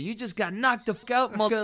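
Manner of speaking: high, loud mock gloat over a knocked-out man; Friday movie quote. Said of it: "you know the movie Friday?".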